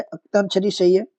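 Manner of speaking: ordinary talk, nothing marked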